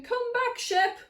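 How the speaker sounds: high-pitched farmer's call; playful shout without full force; mock shout. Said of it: "Look, he's shouting".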